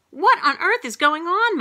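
loud, high-pitched outburst; exasperated cry. A narrator's reading. Mom to nurse about dinosaurs, crying out. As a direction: cry out, utterly baffled. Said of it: "my mom cried".

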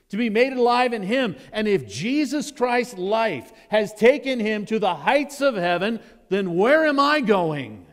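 loud, high preaching at full force; fiery preaching. Acted. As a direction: preach it out with fire.

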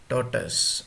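"Tortoise" said as TOR-tus; short "tus" ending on "Tortoise"; correct tortoise. Shown as a demonstration. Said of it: "it is tortoise".

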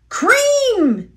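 a high, sharp bitter cry of Cream; bitter outburst. A narrator's reading. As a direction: spit the word out in disgust. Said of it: "he said bitterly".

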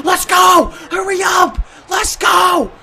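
high-pitched, shouted urging; urgent hype yell. Real, not acted. Gamer urging the team on, high excited shouts. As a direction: holler them on excitedly.

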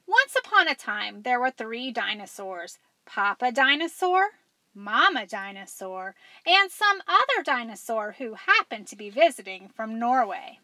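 lively, loud read-aloud storytelling; animated picture-book narrator; story narration. A narrator's reading. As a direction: read it big to the kids.

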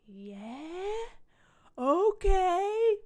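rising yeah, high loud okay; mock enthusiastic reply. Creator acting out a reply to Logitech, mock eager. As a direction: put on an over-keen agreement. Acted reply to brand's retold offer.

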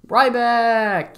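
drawn-out reveal then a laughing sarcastic cry of Ryback; sarcastic mock cheer for the pick; deadpan drag, then a laughing fake-thrilled Ryback; mock excitement. Real, not acted.

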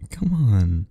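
ordinary delivery

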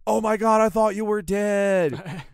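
high, loud cry, drawn out slowly; hammed-up, overjoyed exclamation; mock frantic relief. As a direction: wail it like you have found a lost friend. Retold relief at finding lost friend.

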